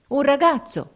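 high, loud sailor's shout; grido dei marinai. A narrator's reading. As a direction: call out for a boy. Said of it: "gridarono i marinai". (grido acuto e forte)

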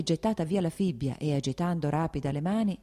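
plain reading voice

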